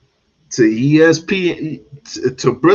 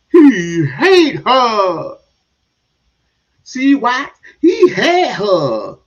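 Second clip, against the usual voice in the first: slow, louder mocking repeat; mock echo; 7 semitones higher, 6 dB louder, much slower than usual. Mocking the player's claim, slow and louder. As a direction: drag the words out mockingly. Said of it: "talking about he hates".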